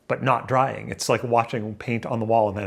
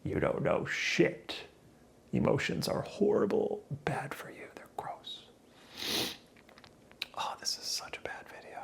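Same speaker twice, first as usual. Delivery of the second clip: whisper; 10 semitones higher, 7 dB quieter than usual. Real, not acted.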